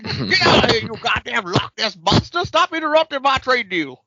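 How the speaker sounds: high, loud, hammed-up shouting at the monster; mock furious yell. The joker shouting at the crab monster over his trade deal, a loud hammed-up yell.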